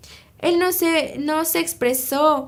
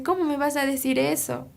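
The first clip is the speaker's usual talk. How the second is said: ordinary talk, nothing marked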